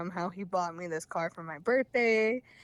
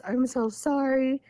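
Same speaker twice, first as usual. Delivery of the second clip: higher, slow, drawn-out imitation of apologizing; mock sweet apology; 5 semitones higher, 4 dB louder, slower than usual. Imitating boyfriend suddenly nice to her, mock sweet apology. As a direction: stretch out an exaggerated apology, pitched up. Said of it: "he was so nice to me".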